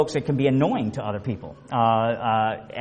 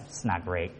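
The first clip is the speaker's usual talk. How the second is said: low, much softer mopey "not great"; glum complainer; 9 semitones lower, 11 dB quieter than usual. Retold or imitated. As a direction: drag it down like a gloomy coworker. Negative person's glum answer.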